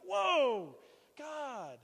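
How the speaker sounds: high, loud whoa sliding downward; astonished whoa. Retold or imitated. Husband reliving his reaction to the sponsorship news, astonished exclamation. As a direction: re-enact being floored, pitched high.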